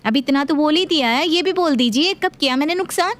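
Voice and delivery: daughter-in-law. Acted. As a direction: demand an answer, getting heated.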